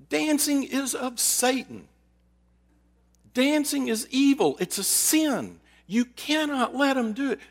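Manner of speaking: high, slow mock outrage at Dancing; preachy imitation of her fury; retold screaming. Church woman condemning the youth dance, mock outrage. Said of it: "She was screaming at me".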